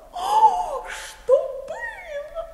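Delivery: что было drawn out slow and high; high wail. Acted. Wife dramatizing her news to her husband, high and drawn out.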